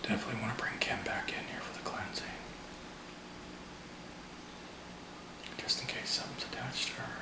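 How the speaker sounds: fast hushed whisper; quick whisper under the breath. Real, not acted. Whispered aside during ghost hunt.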